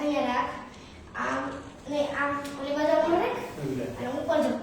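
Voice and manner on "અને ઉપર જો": ordinary delivery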